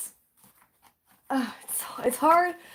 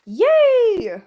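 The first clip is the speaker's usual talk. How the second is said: excited cheer; 9 semitones higher, 8 dB louder than usual. Real, not acted. Cheering about crows.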